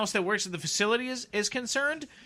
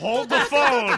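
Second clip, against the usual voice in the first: loud, high, drawn-out announcement; mock urgent halt; 6 semitones higher, 6 dB louder, slower than usual. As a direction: call a halt with mock drama, slowly. Mock interruption, calling a halt.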